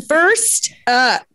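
high announcer voice; announcer call. Acted. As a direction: call it out like a game show host. Announcing game round.

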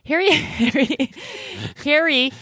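laughing through the name; breaking up with laughter, slowed. Real, not acted. Laughing while reading.

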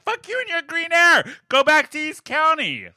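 furious high retold yell; angry yell. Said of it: "I was like".

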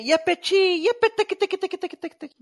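cartoon voice. Imitates a cartoon name and laugh.

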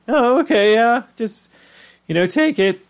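high-pitched mock going-along; mock meek acceptance. Reviewer imitates everyone putting up with the mockery, high meek voice. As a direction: imitate people meekly giving in.

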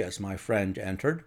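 plain reading voice

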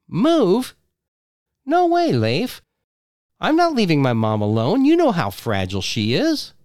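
high, loud, fast refusal; a wife flatly refusing, voice up high; indignant wife. Acted.

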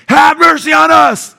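long, high plea hollered across a distance; yelling. Acted. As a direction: yell it slowly to someone far away. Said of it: "they're used to yelling".

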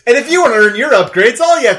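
fast, loud announcer hype; loud hype pitch. Acted. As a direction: punch it out like an ad read. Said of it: "We built up that whole bit".

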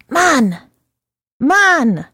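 high, loud call to a rider; a boy hailing a man from a distance. Acted. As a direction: shout down the road to stop him. Boy calls rider from afar.

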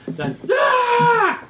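sudden high-pitched yelp; shrill scream. Acted. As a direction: let out a startled shriek. GM voices creature shriek.

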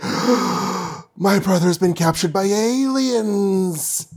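mock-tragic superhero reveal; dramatic Scarlet Witch. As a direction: lay on the melodrama. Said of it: "She's like".

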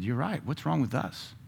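soft, heads-down admission; humbled reply. Retold or imitated. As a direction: quietly own up. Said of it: "dropped their head and said".

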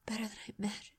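breathy whispered read. Acted. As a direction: hush it like a secret. Reading steamy line, whispered.

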